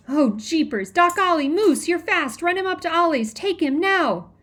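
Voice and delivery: high, loud frantic orders; panicked yelling, pushing someone to run; urgent shouting. A narrator's reading. Boy urgently orders help for baby.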